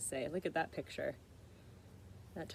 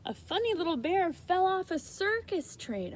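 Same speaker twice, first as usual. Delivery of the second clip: put-on excited child's voice; 9 semitones higher, 4 dB louder than usual. Arnold telling news.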